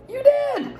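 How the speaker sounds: high squeal of delight. Real, not acted. Finding caramels from a fan, a delighted squeal.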